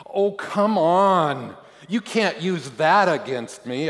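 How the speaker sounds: high, loud, drawn-out protest; desperate objection. A narrator's reading. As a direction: object loudly, starting to get desperate. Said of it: "starting to feel desperate".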